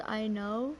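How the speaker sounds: normal talking voice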